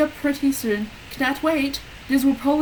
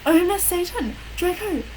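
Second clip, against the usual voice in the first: screamed; 7 semitones higher than usual. A narrator's reading. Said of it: "I screamed".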